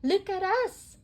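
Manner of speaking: high, loud cowgirl cheer; Jessie voice. Acted. Jessie admiring the set, high loud cheer.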